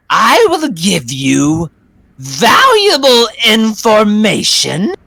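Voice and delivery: slow, high, loud witch offer; bargaining witch. Acted. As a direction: tempt them with choices. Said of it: "she says".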